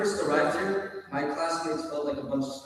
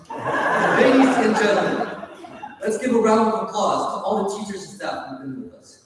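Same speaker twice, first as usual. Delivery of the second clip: podium speech; 7 semitones higher, 8 dB louder than usual. Pretend. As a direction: announce it like a showman. Restarts speech opening grandly.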